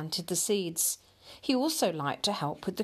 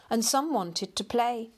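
plain reading voice

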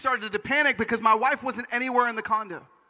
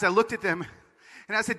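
usual speaking voice

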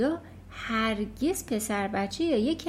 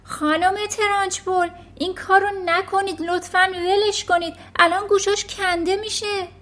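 high, louder pleading call; 8 semitones higher, 5 dB louder than usual. Acted. Miss Honey shouting from the back to stop the headmistress. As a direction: cry out across the classroom. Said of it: "خانم هانی از ته کلاس فریاد کشید".

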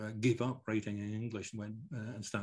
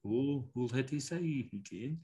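put-on border Scots accent; 3 semitones higher, slower than usual. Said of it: "I, you know".

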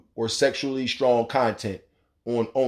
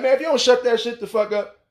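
fed-up yell; 9 semitones higher than usual. Retold or imitated. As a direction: snap at the guest to shut up. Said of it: "I'm like".

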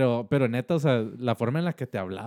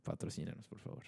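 whispered plea; 3 semitones lower, 19 dB quieter than usual. Real, not acted. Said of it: "Señor Ross, si nos escucha".

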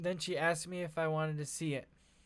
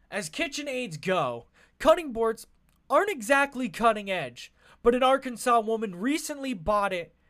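punchy, louder news anchor read; newscaster voice; 4 semitones higher, 8 dB louder than usual. Shown as a demonstration. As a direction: deliver it like an evening news anchor. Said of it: "let me try to do it in my newscaster voice".

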